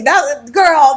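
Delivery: ordinary talk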